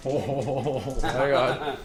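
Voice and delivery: ordinary talk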